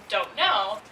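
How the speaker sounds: normal talking voice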